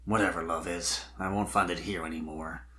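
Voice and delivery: low, soft and hollow; empty inner thought. A narrator's reading. Spike alone on the rock, murmuring emptily. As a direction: think it with nothing left inside. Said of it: "he thought to himself emptily".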